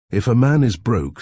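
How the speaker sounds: loud, high, forceful opening; emphatic. A narrator's reading. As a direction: hammer the point home to Reader. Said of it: "said Legg emphatically".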